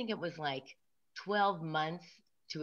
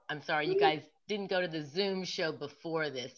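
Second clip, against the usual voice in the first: ordinary talk, nothing marked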